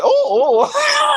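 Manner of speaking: high, playful imitation of a beeping microwave; laughing mock beep. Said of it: "It said".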